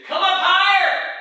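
high, loud, drawn-out call; voice calling from above. Acted. Heavenly voice to the walker, loud high call. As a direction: call it out slowly from afar. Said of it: "You hear a voice say".

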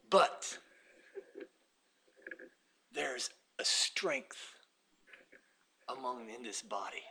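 soft, slow, half-whispered wonder; low reverent near-whisper; hushed awe. Real, not acted. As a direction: draw them in with a hush. Hushed vision of the church.

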